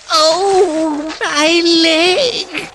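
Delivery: high loud yelp of pain; the cry of a sudden fall. A narrator's reading. Mole tripping in the snow and crying out, pained. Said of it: "he cried".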